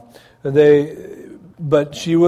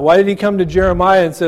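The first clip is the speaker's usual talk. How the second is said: usual speaking voice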